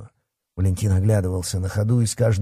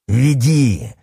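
raised, drawn-out menacing order; barked command; 4 semitones higher, 4 dB louder than usual. A narrator's reading. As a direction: snarl the order at the captive. Werewolf orders his captive onward.

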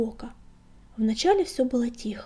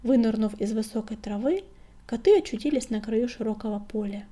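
plain reading voice

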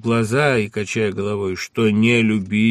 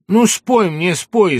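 urgent plea; 10 semitones higher, 8 dB louder, slower than usual. Acted. Urges her to sing.

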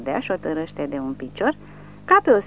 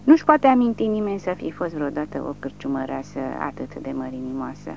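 usual speaking voice

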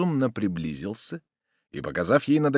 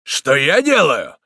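loud, slow, indignant comeback; sharp retort; 5 semitones higher, 10 dB louder, slower than usual. A narrator's reading. Soldier answering the woman who challenged him, sharp retort. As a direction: throw the question back sharply, raised voice. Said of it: "резко отвечал солдат".

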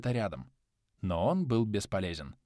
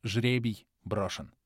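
plain reading voice

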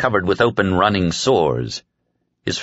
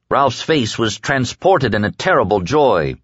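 plain reading voice

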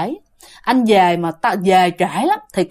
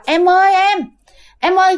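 husband calling at the door; 7 semitones higher than usual. Retold or imitated. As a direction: call through the door as the husband in a raised voice. (giọng chồng gọi cửa, cao và to hơn)

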